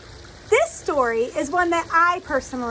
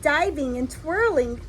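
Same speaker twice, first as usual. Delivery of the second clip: lively storybook reading voice; reading to children; 4 dB quieter than usual. A narrator's reading. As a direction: read it like a picture book to little kids. Reads a picture book aloud.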